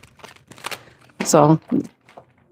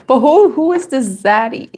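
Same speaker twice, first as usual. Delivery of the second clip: laughing through the words, pitched up; giggling; 7 semitones higher than usual. Real, not acted. Teasing a viewer about the word zaddy, giggling throughout. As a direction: tease the viewer while giggling.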